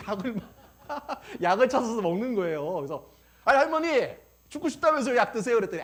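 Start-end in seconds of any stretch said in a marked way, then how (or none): 3.5-5.6 high, loud mock protest; teasing challenge. Pastor catching grandmother taking her medicine, loud teasing protest. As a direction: call out the contradiction to granny.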